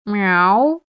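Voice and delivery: high, strained cat meow; tense meow. A narrator's reading. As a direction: meow tautly like an uneasy cat. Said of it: "напряжённо ответил туман". (напряжённое мяуканье)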